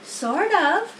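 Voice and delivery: a lightly voiced wise spider, patient; Charlotte voiced. A narrator's reading. Spider tells the pig he can't spin a web, lightly voiced.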